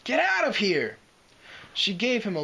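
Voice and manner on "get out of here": high, loud yell; shouting at friends to escape; frantic shout. A narrator's reading. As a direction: scream at them to get out. Spike yelling at friends to go.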